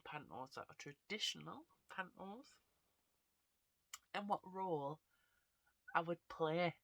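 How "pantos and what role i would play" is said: odd put-on voice. Real, not acted. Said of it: "I don't know why I'm talking like this".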